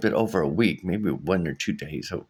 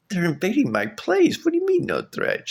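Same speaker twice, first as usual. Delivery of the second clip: incredulous outrage; 13 semitones higher than usual. Retold or imitated. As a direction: squeal it like you can't believe her. Arguing with passing neighbor lady.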